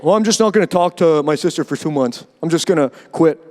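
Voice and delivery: low, pouting mock voice of someone giving up; sulky quitter. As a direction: grumble like a sibling cutting off family. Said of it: "Family's difficult".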